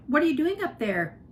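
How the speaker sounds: mother yelling up to Flora; mother shouting. A narrator's reading. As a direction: call up to her daughter. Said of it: "her mother shouted".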